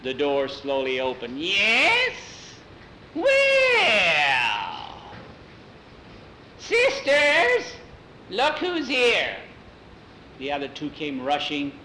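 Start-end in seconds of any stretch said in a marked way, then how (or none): 1.4-9.4 very high, drawn-out witch screech; witch shriek. Acted. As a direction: shriek out gleefully to the other witches. Witch answers the door.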